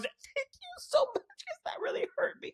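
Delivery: high, breathy mock-victim squeak; imitating Lisa's fake hurt in a high whispery squeak; mock wounded Lisa. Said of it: "she just immediately goes".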